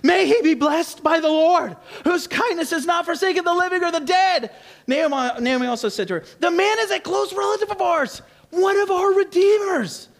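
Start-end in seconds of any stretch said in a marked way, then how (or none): 0.0-4.5 much higher, thrilled and laughing; excited Naomi. Shown as a demonstration. Naomi thrilled at Ruth's news, high and excited. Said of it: "in the excitement that Naomi would have had". 6.4-10.1 much higher, thrilled and laughing; excited Naomi. Shown as a demonstration. Naomi thrilled at Ruth's news, high and excited. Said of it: "in the excitement that Naomi would have had".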